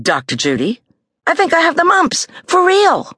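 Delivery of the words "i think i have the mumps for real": eager insisting. A narrator's reading. Judy insisting she is sick.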